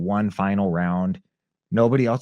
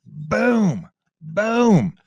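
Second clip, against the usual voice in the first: boom; 12 semitones higher, 5 dB louder than usual. Retold or imitated. Sound effect for kicks.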